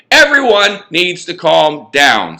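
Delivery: slow down and take control of the room; firm call to order. Acted. Mason pounding the table to silence his friends, loud and slow. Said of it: "pounded the table for attention".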